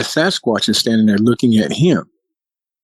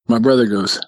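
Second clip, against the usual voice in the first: plain reading voice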